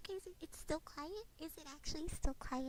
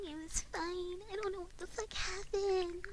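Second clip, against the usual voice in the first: normal talking voice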